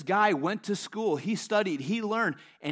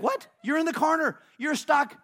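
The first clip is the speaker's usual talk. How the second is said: high, loud, incredulous devil; baffled devil; 8 semitones higher, 5 dB louder than usual. Retold or imitated. Devil answers the preacher he thought was cornered, high loud disbelief. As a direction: protest in disbelief, pitched up. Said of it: "And he's like".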